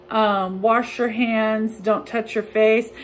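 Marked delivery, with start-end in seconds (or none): none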